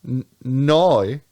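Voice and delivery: NOI for "no" in a botched Australian accent; bad Australian accent. Shown as a demonstration. Would-be Australian showing he can't do the accent, botched Aussie NOI. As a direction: attempt an Australian NOI, vowel stretched and bent. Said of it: "trying to do, like, an Australian accent".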